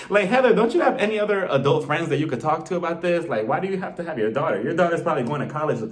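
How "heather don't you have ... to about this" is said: ordinary delivery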